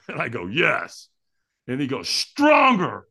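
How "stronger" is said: high, loud retold command; trainer's shout. Trainer urging firewalker to give more, shouted command. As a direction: push them like a fired-up trainer. Trainer demands a stronger yes.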